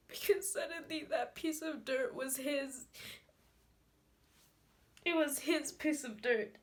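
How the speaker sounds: loud, high-pitched tearful outpouring; anguished crying. Acted. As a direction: cry it out forcefully, gasping between phrases. Grieving monologue, desperate over father's burial.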